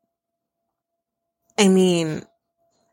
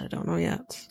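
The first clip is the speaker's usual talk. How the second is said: dropped much softer, almost to herself; soft repeat; 2 semitones lower, 11 dB quieter than usual. Shown as a demonstration. Host, paused mid-episode, softly repeats she doesn't know. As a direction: trail off under her breath. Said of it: "I've paused it".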